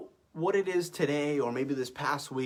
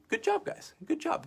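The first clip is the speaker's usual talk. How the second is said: high, bright good job; cheery high praise; 14 semitones higher than usual. Real, not acted. Praising the class after drill.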